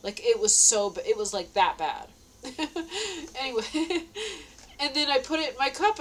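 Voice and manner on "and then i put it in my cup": normal talking voice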